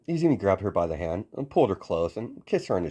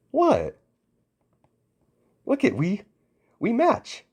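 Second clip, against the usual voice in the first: much higher and louder, giddy and bouncy; excited squeal; 14 semitones higher, 3 dB louder, slower than usual. Acted. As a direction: squeal it like a delighted girl. Mina delighted they share pink.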